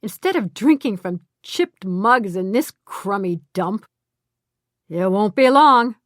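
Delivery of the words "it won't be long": a crowing boast, louder. A narrator's reading. Woodrow crowing to his partners about the payoff. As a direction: gloat that it's nearly done. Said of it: "Woodrow crowed".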